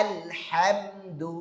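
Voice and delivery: আলহামদু drawn out very slowly; slow Quran drill. Shown as a demonstration. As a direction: spell out আলহামদু syllable by syllable. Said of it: "আপনি যেরকম".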